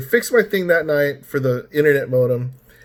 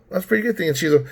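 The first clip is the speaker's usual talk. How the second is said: ordinary talk, nothing marked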